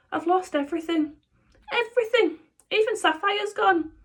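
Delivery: high wailing lament; despairing dad. Acted. Ruined father lamenting to his son, high wailing. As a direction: wail about losing it all.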